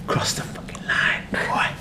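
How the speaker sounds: a hushed, mock-sinister whisper; menacing whisper. To the dog, mock villain whisper. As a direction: whisper a playful threat like a movie villain.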